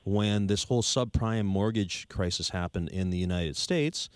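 ordinary talk, nothing marked

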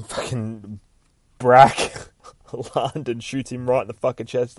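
ordinary delivery

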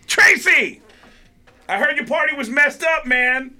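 loud, high excited hail; calling out. Retold or imitated. Comic greeting a colleague he hasn't seen in a year, calling out. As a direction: holler it gleefully across the hallway. Calling out to comedian rounding corner.

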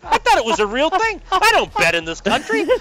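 high-pitched mock astonishment; flustered realization. Reporter retelling how he learned the joke, flustered surprise. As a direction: protest innocence, voice jumping up high.

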